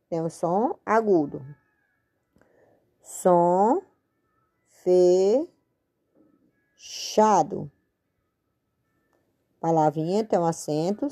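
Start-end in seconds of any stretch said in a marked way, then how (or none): 3.0-7.7 fechado split into fe... chado with long gaps; slow syllable by syllable. Shown as a demonstration. Teacher writing the label for pupils, slow syllables. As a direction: dictate Som fechado piece by piece.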